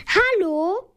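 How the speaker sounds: high, insistent call for attention; a hint of a shouted hello; loud call. A narrator's reading. Flo calls to Papa's boss for attention, high mock call. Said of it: "ruft Flo nun richtig laut".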